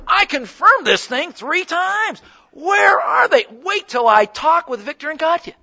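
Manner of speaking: high-pitched mock exasperation building to a shout; mock provoked anger. Preacher hamming up his fury at the no-show missionaries. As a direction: act out the temptation to blow up, half in jest. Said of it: "I could become easily provoked".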